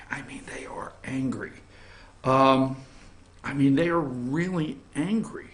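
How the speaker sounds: hushed mock whisper. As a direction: whisper it like a secret. Whispering about CNN anger.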